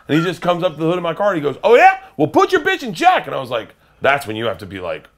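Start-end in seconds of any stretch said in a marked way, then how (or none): 1.6-3.3 threatening shout. Retold or imitated.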